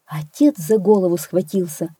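usual speaking voice

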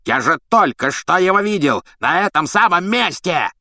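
high, strained, enraged protest; furious yell. A narrator's reading. Executioner insisting he saw it, furious. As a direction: protest in a rage, pitch flying up. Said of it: "с бешенством заорал палач Макнейр".

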